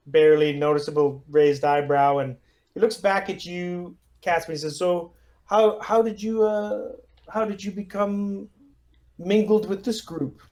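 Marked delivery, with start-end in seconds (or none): 4.8-10.4 put-on Russian accent. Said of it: "rough, Russian sort of accent".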